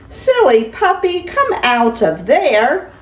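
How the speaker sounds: lively, sing-song picture-book reading; grandma reading a bedtime story; reading to children. Acted. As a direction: read it to a small grandchild with delight. Grandma reads picture book aloud.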